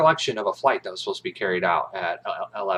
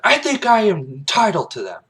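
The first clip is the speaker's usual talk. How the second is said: raised, firm insistence; insistent reply; 11 semitones higher, 5 dB louder than usual. Retold or imitated. Kaffee insists on answers, pitched up and firm. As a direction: stand your ground. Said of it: "Kaffee's like".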